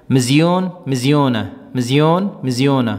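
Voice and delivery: مزیون rising, then مزیونہ falling, twice; drill pair. Shown as a demonstration. Teacher drilling masculine and feminine forms for students. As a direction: chant مزیون and مزیونہ back and forth as a drill. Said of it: "نہ زیادہ اوپر جانا ہے".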